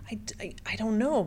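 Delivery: quick stammering whisper; real whisper. Real, not acted. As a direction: let it slip out voiceless and fast. Painful admission while telling story.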